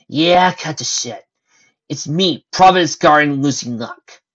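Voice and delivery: annoyed interruption. Acted. Lucy cuts off the hotline.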